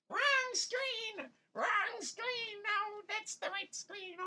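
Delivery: flustered squeal. Real, not acted. Streamer flustered at showing the wrong screen, squealing.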